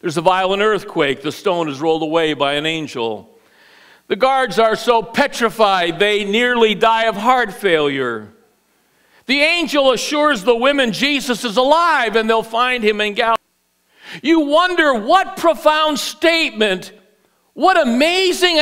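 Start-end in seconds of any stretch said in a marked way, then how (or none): none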